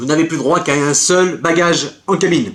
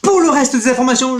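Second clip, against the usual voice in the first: ordinary delivery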